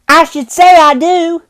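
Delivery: put-on high, bright young lad's voice; a cocky boy calling up to the window; cheeky street boy. Boy replies.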